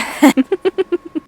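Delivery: high burst of laughter; real laugh. Real, not acted. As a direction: laugh at your own fright. Laughs at own scare.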